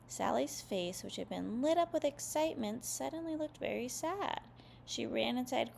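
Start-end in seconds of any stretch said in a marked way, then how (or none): none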